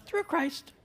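"Through Christ" said very softly and high, almost lost; tiny print voice. Shown as a demonstration. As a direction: shrink "Through Christ" to a tiny soft afterthought. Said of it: "the little part at the bottom goes".

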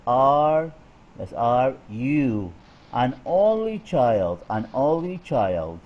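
put-on slow, with "you" and "only child" drawn out; stress on you, only child. Shown as a demonstration. Teacher modelling question stress on "you" and "only child". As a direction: lean on "you", then lift "only" in "only child". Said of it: "فركزنا على you و only child".

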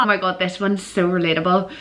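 ordinary talk

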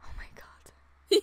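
slow, awed whisper. Real, not acted. Fan staring at her game, slow awed whisper. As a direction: breathe it out slowly in awe.

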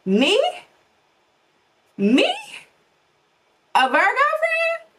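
high, slow, stretched-out mock shock; mock disbelief. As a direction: squeal it in pretend disbelief. Mock shock at Virgo news.

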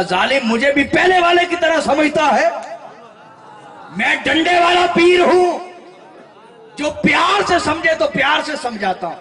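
furious high shouting rebuke; angry shout. Acted. Holy man shouting at the fake tester in fury. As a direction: roar it out like an enraged saint with a stick. Angry pir beating the melon seller.

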